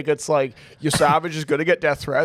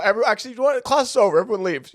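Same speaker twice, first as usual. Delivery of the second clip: hammed-up teacher, higher and louder; mock teacher; 7 semitones higher, 6 dB louder, faster than usual. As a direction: dismiss the class like a strict teacher. Playing teacher dismissing class.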